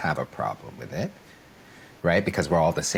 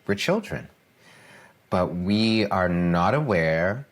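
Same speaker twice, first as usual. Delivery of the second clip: usual speaking voice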